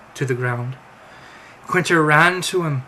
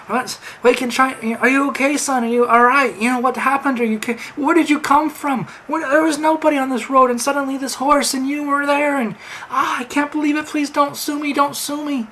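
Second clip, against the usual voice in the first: flustered; 10 semitones higher, 4 dB louder than usual. Acted. Stranger panics after hitting horse.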